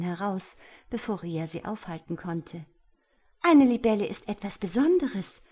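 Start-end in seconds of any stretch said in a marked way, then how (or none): 3.4-5.4 indignant, raised and louder; emphatic self-defence; defensive. A narrator's reading. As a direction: stand up for yourself with wounded pride. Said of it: "verteidigte sich Rias Schutzgeist".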